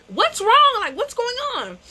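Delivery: high alarmed questions; a startled young voice asking; alarmed niece. Retold or imitated. Niece alarmed at the fight, asking high and worried.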